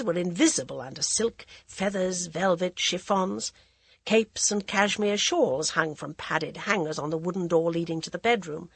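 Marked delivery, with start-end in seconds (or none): none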